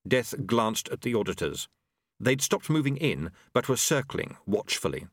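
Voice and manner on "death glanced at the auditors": plain reading voice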